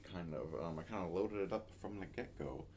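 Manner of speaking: ordinary talk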